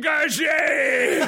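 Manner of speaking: high, loud, slow French Engagé; mock French order shouted high; hammed French. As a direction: belt Engagé as a Clouseau-style captain. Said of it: "French accent play".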